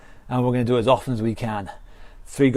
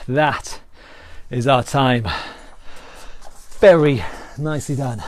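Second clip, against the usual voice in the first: normal talking voice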